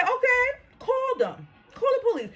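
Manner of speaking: high-pitched, taunting retold dare; mock-agreeable, high and challenging; daring retort. Retold dare to office manager.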